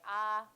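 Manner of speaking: loud open sustained Ah. Shown as a demonstration. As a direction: hold out a clear Ah to show voicing. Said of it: "for me to say".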